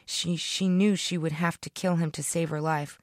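ordinary delivery

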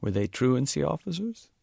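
put-on an elderly man surprised by the odd accusation; puzzled old Sikh.

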